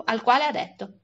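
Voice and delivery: usual speaking voice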